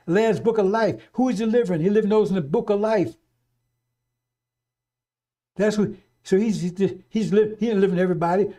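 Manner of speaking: loud, high fire-and-brimstone preaching; preaching at full force. Acted. Preacher warning the brothers and sisters, in full-force preaching. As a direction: hammer it home from the pulpit.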